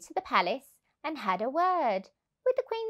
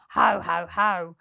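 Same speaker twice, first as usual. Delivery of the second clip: louder, lower "Ho, ho, ho" as a sly chuckle; villain chuckle; 3 semitones lower, 5 dB louder than usual. A narrator's reading. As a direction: chuckle like a plotting crook. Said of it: "heard them chuckle".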